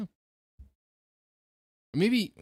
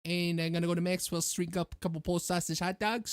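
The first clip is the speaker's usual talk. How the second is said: put-on light Chicago accent, pitched up; 5 semitones higher than usual. Co-host asked for a Chicago accent, does it. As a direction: order hot dogs like a Chicago local. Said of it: "give me your best Chicago accent".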